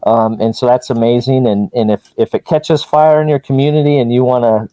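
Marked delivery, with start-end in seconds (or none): none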